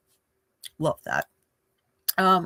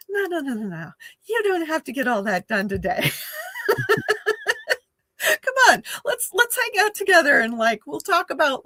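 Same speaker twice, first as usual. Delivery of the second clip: soothing friend; 9 semitones higher than usual. Acted. Soothing friend talking someone out of finishing today's list, playful coaxing. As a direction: coax like a friend waving the worry away, pitched up. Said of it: "this soothing friend being like".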